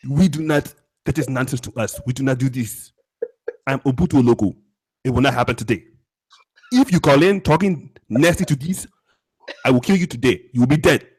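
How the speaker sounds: stern mock African accent. Co-host warning anonymous callers, stern African accent. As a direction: lay down the law in a put-on African accent. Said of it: "I will use my African voice".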